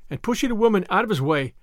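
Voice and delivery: plain reading voice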